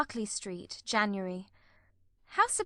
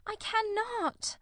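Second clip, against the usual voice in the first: slow, much higher, breaking off; cried; 4 dB quieter, slower than usual. A narrator's reading. Marianne repeating her refusal, slow anguished cry. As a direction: let the second one break higher and slower. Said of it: "cried Marianne".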